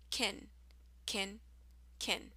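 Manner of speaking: "kin" short vowel, softly repeated. Shown as a demonstration. Teacher drilling the reduced "can" sound, softly. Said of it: "Can actually sounds like kin".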